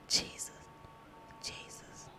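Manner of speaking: tiny, hesitant-paced whisper. Retold or imitated. Praying alone in jail, whispering. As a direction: whisper the name, pausing between each. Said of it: "I started whispering".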